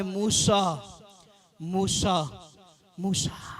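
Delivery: low, soft voice of God, fading; God calling Moses. Acted. God calls Moses by name, solemn low soft voice. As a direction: repeat the name deep and quietly, spaced out. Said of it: "क्या कहा खुदा ने?".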